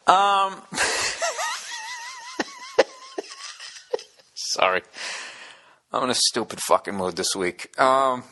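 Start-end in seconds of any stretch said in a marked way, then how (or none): 0.7-2.8 squealing high-pitched giggle; real giggle fit, high and breathy; high laugh. Real, not acted. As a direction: crack up at your own joke. Breaks up laughing at own bit.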